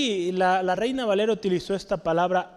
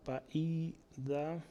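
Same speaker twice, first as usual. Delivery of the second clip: paidagogos drawn out very slowly and much softer; dictated while writing; 2 semitones lower, 12 dB quieter, much slower than usual. Shown as a demonstration. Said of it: "Lo voy a escribir aquí". (paidagogos dictado despacio y en voz baja)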